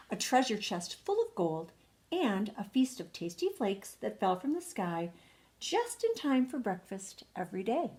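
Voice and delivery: ordinary delivery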